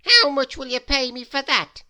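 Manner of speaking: loud, high, grudging grumble; annoyed. Acted. Mister Mean annoyed at being disturbed, loud and grumpy. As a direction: snap it out, put out at the bother. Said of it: "most annoyed about being disturbed".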